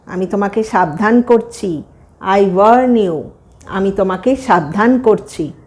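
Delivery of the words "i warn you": "I warn you" said very slowly; slow teaching repeat. Shown as a demonstration. Teacher repeating "I warn you" very slowly for students. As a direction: lean slowly through "I warn you" for learners.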